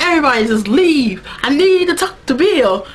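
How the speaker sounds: high, emphatic acted demand; Sookie. Sookie needs to talk to Bill, mock demanding. Sookie orders everybody out after screaming.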